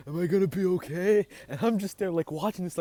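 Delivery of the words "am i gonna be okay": put-on high, loud frightened plea from a scared kid; scared little sister. Sister asks mom if she'll be okay, high and loud. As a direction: beg the question out high and loud. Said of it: "And she's like".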